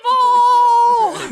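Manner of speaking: loud, high cartoon shriek. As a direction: scream it up high. Echoing Aunt May's shriek.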